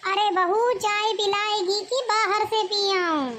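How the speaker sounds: sharp nagging summons from another room; calling mother-in-law. Acted.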